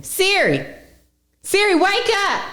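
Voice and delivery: high-pitched call to wake a voice assistant. Real, not acted. Calls to the phone assistant.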